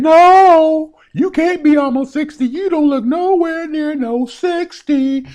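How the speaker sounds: high, loud, slow disbelieving voice; imagined viewer. Pretend. Viewer protesting his age, high loud disbelief. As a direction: play the shocked viewer refusing to believe it. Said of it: "You're probably saying".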